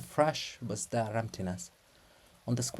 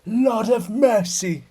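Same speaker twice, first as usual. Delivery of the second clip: high, louder slow cry to heaven; anguished appeal to God; bitter outcry; 10 semitones higher, 7 dB louder, slower than usual. Acted. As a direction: cry it out in despair. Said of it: "lets out a bitter breath".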